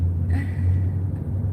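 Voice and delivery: voiceless chuckle; short, breathy burst of laughter. Real, not acted. Bartender disbelieving the customer's behavior, chuckle.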